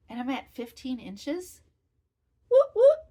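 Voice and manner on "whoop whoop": much higher, much louder cheer; excited whoop whoop. Real, not acted. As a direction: shout it like a mini party. Celebrating progress on cardigan.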